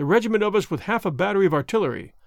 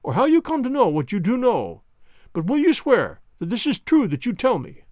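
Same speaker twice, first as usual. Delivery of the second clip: anxious pleading; 5 semitones higher than usual. Acted. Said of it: "he cried".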